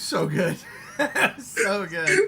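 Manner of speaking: laughing delight; high laughing rave. Real, not acted. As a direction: giggle it out with joy. Excited over movie scene.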